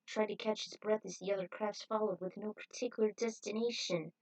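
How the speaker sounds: plain reading voice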